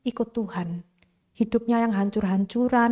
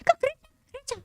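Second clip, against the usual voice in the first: much higher, mocking scornful snap at the daughter; angry father; 3 dB quieter, much faster than usual. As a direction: sneer at her churchgoing. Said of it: "ayahnya marah-marah". (ayah marah mengejek, suara jauh lebih tinggi)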